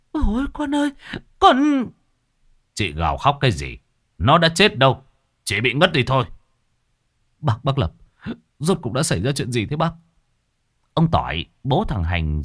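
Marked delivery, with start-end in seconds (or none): 0.1-1.9 very high wailing cry; mother wailing. Acted. Mother wailing over her unconscious son. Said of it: "mẹ nó gào lên". 2.7-6.3 louder, higher rebuke; stern scolding. Acted. Exorcist scolding the wailing mother, loud and stern. 7.4-9.9 higher, hesitant asking; timid father. Acted. Frightened father timidly asking the exorcist. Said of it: "rụt rè lên tiếng".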